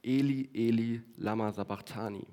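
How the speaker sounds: ordinary talk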